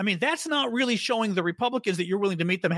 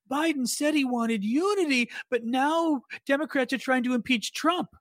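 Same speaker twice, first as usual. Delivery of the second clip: mocking Republican voice; 7 semitones higher, slower than usual. Mocking Republican talking point.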